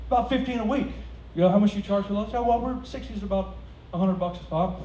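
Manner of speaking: lightly voiced customer; mock gruff buyer; imitating client. Client negotiating ad buy.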